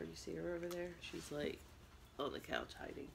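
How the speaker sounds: soft whisper to the camera; barely voiced, don't disturb her; hushed aside about the dog. Real, not acted. Pointing out hiding dog quietly.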